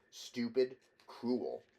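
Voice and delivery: ordinary delivery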